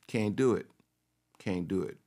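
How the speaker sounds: normal talking voice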